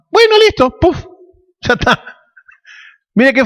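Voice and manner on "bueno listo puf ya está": high-pitched, casual mock agreement; mock offhand compliance. Preacher playing God granting a death wish, mock offhand. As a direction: toss it off high, as if it were instantly done.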